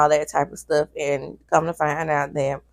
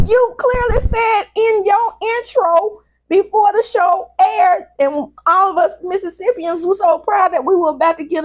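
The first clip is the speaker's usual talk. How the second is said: worked-up rant; 11 semitones higher than usual. Real, not acted. Ranting at castmember about Mississippi.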